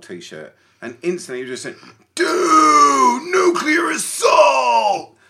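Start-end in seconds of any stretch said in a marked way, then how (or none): 2.1-5.2 booming, high American shout; drawn-out American-accented yell. Retold or imitated. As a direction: bellow it like an excited American fan, last word drawn out. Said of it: "why are you doing an American accent".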